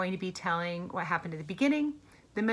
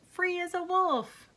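high, drawn-out cry of freedom; excited yelp; 10 semitones higher than usual. A narrator's reading. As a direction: crow it high and slow, storybook style. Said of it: "he yelped".

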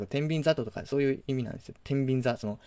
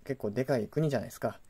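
ordinary talk, nothing marked

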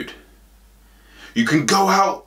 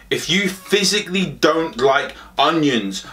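normal talking voice

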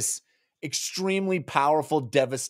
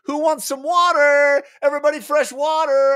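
hammed-up call out; 13 semitones higher, 6 dB louder than usual. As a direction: announce it across the deck. Sailor calling out to crew.

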